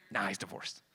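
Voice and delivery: low, quiet dismissal; offhand reversal. Acted. Comic fan showing retcons flip back and forth, dismissive. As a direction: drop it low and soft, waving it away.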